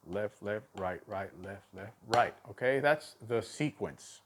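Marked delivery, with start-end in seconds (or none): 0.1-2.3 low, soft pairs with the final RIGHT standing out; even low chant, weight on last RIGHT; accented sticking count. Shown as a demonstration. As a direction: recite the sticking quietly, land on "right".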